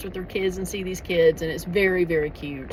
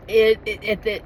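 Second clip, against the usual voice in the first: ordinary talk, nothing marked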